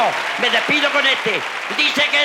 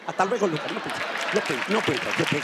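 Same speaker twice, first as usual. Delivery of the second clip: low, strained can't-do-it groan; comic "no puedo" whine; strained catchphrase voice; 6 semitones lower, 4 dB quieter, much faster than usual. Acted. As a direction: moan it like you're failing.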